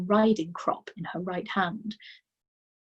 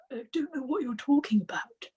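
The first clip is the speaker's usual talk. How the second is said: put-on higher, bewildered boy's voice; puzzled boy; 7 semitones higher than usual. A narrator's reading. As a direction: sound lost and confused. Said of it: "more puzzled than ever".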